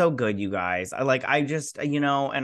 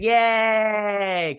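high, louder yay; cheering; 8 semitones higher, 5 dB louder than usual. Retold or imitated. Vlogger recalling cheering when Pat escapes, high and loud. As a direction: cheer like a kid at good news.

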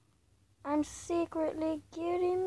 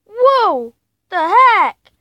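louder, sudden shock; startled loud whoa; 3 semitones higher, 12 dB louder, slower than usual. Real, not acted. Gamer startled by the falling ball, loud surprise. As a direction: jolt back at the surprise.

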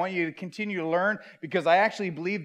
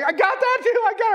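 much higher, louder triumphant cry; excited; 3 dB louder, faster than usual. Retold or imitated. Dad at the mall finally seeing the magic eye, excited cry. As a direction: shout it like you finally cracked it. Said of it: "I was so excited".

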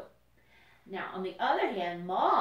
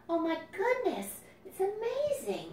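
amazed; 10 semitones higher than usual. Real, not acted. Marvelling at caterpillars with kids.

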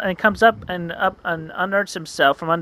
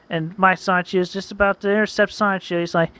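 plain reading voice

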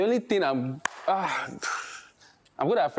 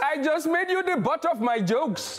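put-on higher Indian accent, smug; 7 semitones higher than usual. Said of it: "Indian accent".

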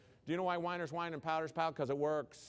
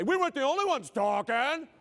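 loud, high mock student whine; hammed-up, high-pitched objection; indignant student protest; 11 semitones higher, 9 dB louder than usual. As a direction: protest loudly like an accused kid. Acting out a defiant student's protest.

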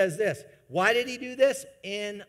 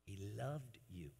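much softer, lower, gentle; tender hush; 5 semitones lower, 14 dB quieter than usual. Real, not acted. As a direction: drop to an intimate hush. Tender aside after building up.

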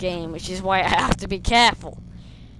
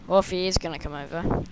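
ordinary delivery

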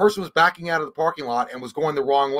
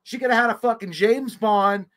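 ordinary talk, nothing marked

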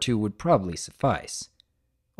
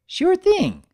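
put-on high, loud, gushing little-girl voice; little sister thrilled about summer vacation; excited filly voice; 12 semitones higher, 5 dB louder than usual. Narrator voices Apple Bloom.

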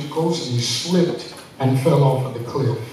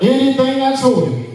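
call and response lead; 9 semitones higher, faster than usual. Acted. Preacher leads, congregation repeats.

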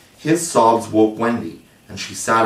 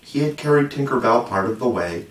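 usual speaking voice

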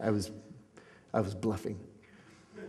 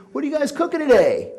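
mock cop voice; 12 semitones higher, 4 dB louder than usual. Cops asking in a retold scene.

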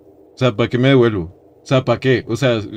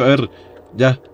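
normal talking voice

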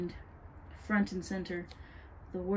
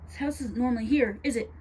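raised, pointed accusing question; 5 semitones higher, 6 dB louder than usual. A narrator's reading. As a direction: challenge her with suspicion. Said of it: "she accused".

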